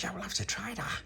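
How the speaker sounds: soft, unvoiced reply; whisper. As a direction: whisper it like a secret plan. Whispered line in story.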